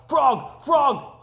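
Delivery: high, loud cry; excited shout. A narrator's reading. Toad running to Frog's house, shouting joyfully. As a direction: yell it out as you run in. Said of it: "he cried".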